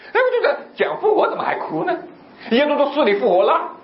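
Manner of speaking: high-pitched baffled exclamation; mock bafflement. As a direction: puzzle over it in comic disbelief. Retelling bafflement at their crying. (夸张地纳闷不解)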